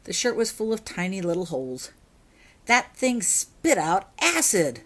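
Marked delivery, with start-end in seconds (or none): 2.6-4.8 complaining. A narrator's reading. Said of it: "he complained".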